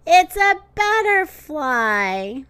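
understated giggly reveal; giggling. A narrator's reading. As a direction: draw it out with a touch of a giggle. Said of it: "giggled Thumper".

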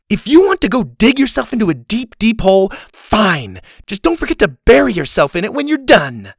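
loud, high-pitched scolding; angry tirade. A narrator's reading. Girl telling off the boy digging a hole, furious.

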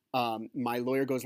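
normal talking voice

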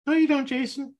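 high, loud, mocking little inner voice; subconscious voice. The subconscious scoffing at Jason's affirmation, high and mocking. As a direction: squeak it out like a doubting gremlin in your head. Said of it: "my subconscious voice".

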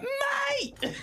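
high laughing outcry; laughing protest. Retold or imitated. Telling his radio co-host, laughing in high disbelief. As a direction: cry out through a laugh.

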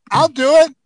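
eager volunteer shouting across the room; Ron Jeremy calling out. Retold or imitated. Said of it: "this voice in the back of the room goes".

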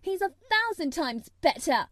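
high, loud, emphatic; heated retort. A narrator's reading. Mary championing Dickon against Colin, heated. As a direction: top it with defiance. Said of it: "retorted Mary".

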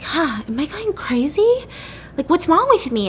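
acted wondering if she's losing it; bewildered self-doubt. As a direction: pitch it up in baffled disbelief. Retold self-doubt after denials.

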